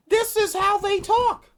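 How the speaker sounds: near-shout of disbelief; exasperated outburst. Real, not acted. Venting to viewers about how officials talk, loud exasperated cry.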